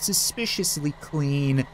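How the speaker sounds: ordinary talk, nothing marked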